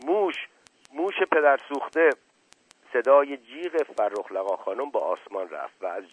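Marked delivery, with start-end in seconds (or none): 0.1-2.1 high, loud cry of alarm; shouting. Acted. Asadollah Mirza yells to break up the gathering, loud alarmed shout. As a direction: yell it to cause a panic. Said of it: "فریاد کشید".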